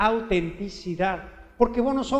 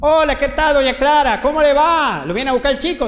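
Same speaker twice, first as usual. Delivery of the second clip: bright, louder, higher neighborly greeting; mother greeting neighbor; 6 semitones higher, 7 dB louder than usual. Retold or imitated. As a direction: mom calling at the door, cheerful. Madre voiced greeting neighbor. (la mamá saluda alegre y fuerte)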